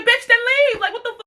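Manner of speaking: very high-pitched mock outrage; squealed, retold indignation; exasperated retort. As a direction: act out a fed-up comeback, voice shooting way up. Acted retort in retold argument.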